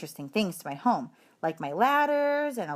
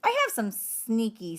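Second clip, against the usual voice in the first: ordinary delivery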